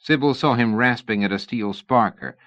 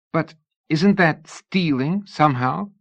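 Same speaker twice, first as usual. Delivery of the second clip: a worried lady pressing for answers, pitched up; young lady Sybil, anxious; 8 semitones higher, 3 dB louder than usual. A narrator's reading. Narrator voices Sybil.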